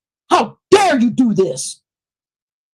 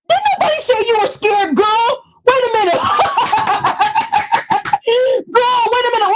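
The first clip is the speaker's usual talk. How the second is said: squealing high with laughter, mocking her; high-pitched taunting laugh at a rival; 10 semitones higher than usual. Real, not acted.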